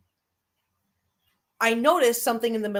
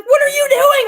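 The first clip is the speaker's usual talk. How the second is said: high, loud confused shout; startled yell; 10 dB louder than usual. Retold or imitated. Brothers to her running out, high startled shout. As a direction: shout in alarm at a sister.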